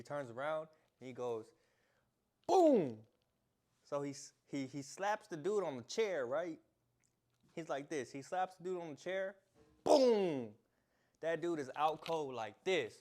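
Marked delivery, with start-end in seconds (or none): 2.5-3.0 very loud, high Boom; shouted boom. Retold or imitated. Acting out the slap. 9.8-10.5 very loud, high Boom; shouted boom. Retold or imitated. Acting out the slap again.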